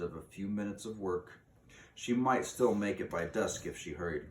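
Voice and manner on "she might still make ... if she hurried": usual speaking voice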